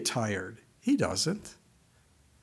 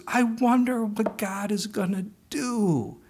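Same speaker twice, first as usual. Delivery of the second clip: slow, savoring; 8 semitones higher than usual. Shown as a demonstration. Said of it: "Those little words".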